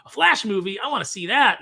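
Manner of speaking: loud and high, thrilled; excited. Retold or imitated. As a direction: fan gushing at the news. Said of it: "you'd be like".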